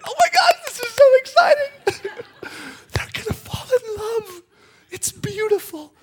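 very high, loud giddy squeal with laughter; squealing angels. Acted. Watching angels squeal with excitement. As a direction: squeal like fans at a wedding. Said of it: "They're like".

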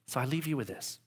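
ordinary delivery

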